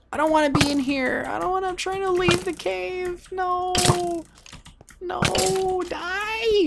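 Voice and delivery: very high, panicked wail; high-pitched frantic protest; panicked squeal. Pretend. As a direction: squeal in game panic, pitch shot right up. Trapped in cave under attack.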